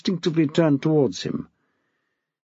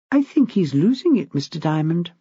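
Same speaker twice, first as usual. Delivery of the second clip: a touch higher, a hint of a worried young teacher; lightly voiced young woman; 4 semitones higher than usual. A narrator's reading. Anxious teacher reporting to the head, lightly voiced. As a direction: voice Nicole a little higher than the narration. Nicole's voice in the story.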